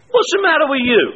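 loud high accusing question; laughing mock accusation. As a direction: fling the blame back at him. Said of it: "what I always said was".

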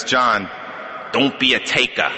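imitated Brooklyn accent, dropped R in "taker"; mock Brooklyn accent; Jerry McBride. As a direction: give it as Brooklyn advice. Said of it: "in that Brooklyn accent".

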